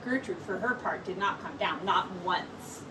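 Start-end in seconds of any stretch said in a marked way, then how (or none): none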